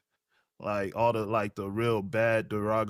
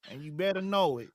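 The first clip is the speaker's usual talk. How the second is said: mock Southern threat, pitched up; Southern accent on request; 4 semitones higher than usual. Finishing the requested sketch in a light Southern accent. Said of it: "could we hear [name]'s Southern maga country accent".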